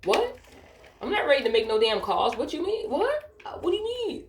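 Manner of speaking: high-pitched, baffled objection; retold incredulous protest. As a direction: act out baffled protest, voice leaping up on the questions. Retold protest at taking calls.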